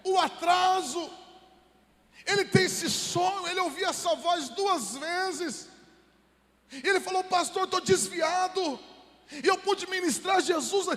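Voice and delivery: high, loud fervent preaching; impassioned pastor's appeal; preaching at full force. Acted. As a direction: drive the message home to the church. Sermon climax, high and loud.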